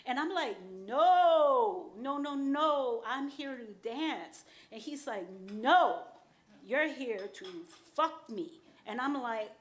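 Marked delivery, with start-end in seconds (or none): 0.9-4.4 shouted no, then slowing into I'm here to dance; loud refusal. Retold or imitated. Refusing the man who hired her, loud and slow. 5.5-8.6 loud demand. Retold or imitated. Client insists, quarrel.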